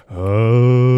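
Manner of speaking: low drawn-out zombie moan. Retold or imitated. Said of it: "no matter what you're doing".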